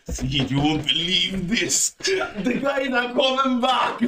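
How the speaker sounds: high-pitched, drawn-out laughing disbelief; amused disbelief. Retold or imitated. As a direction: tell a mate the impossible, voice climbing high with laughter. Retells alarm at guards turning back.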